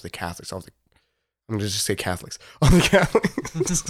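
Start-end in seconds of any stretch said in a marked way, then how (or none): 2.6-3.8 laughing through the words. Real, not acted. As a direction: laughs out the correction. Laughing at own joke.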